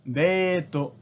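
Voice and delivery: deto as de-to, long e; Japanese loanword. Shown as a demonstration. Teacher showing the Japanese reading: デート. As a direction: date said as deto. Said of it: "se convierte en".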